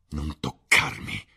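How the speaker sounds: slow, forceful cry; cried out. A narrator's reading. Dorian pushing Sibyl away, crying out. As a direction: push it out slowly as a forceful warning. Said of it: "gridò".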